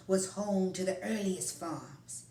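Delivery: ordinary delivery, nothing marked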